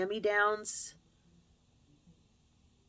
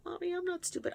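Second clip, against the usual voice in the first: a lightly voiced small child, pitched up and soft; hurt little girl; 12 semitones higher, 4 dB quieter, faster than usual. A daughter protesting to her mother, in a light, soft child's voice. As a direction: plead as the hurt kid.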